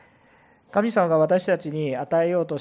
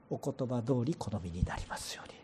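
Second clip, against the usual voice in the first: soft unvoiced whisper, lower; whispered humble quote; 4 semitones lower, 7 dB quieter than usual. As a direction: breathe Mary's words as a humble whisper. (囁くように謙虚に)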